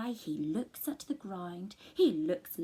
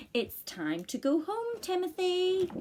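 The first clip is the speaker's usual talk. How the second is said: dad calling; 9 semitones higher than usual. Acted. Dad calling son home.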